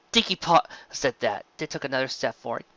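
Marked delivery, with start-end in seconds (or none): none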